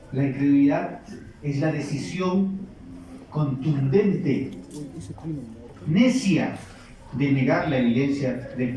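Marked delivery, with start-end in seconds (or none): none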